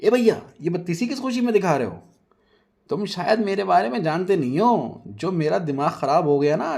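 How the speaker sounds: high, loud angry threat. Acted. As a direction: tell him off furiously. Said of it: "Nazia गुस्से में पूछती है".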